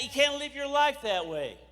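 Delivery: ordinary talk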